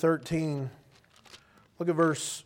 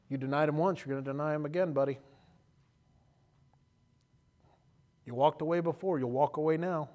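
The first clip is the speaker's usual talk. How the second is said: soft taunting devil voice, much softer; devil whispering; 6 dB quieter than usual. Retold or imitated. The devil taunting Peter, soft and sly. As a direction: hiss doubts at Peter like the devil. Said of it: "the devil was whispering".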